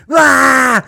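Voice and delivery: high, loud mock rant; a hammed-up furious father yelling at home; imitating raging dad. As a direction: blow up like dad stomping in after work. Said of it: "he comes home and he goes".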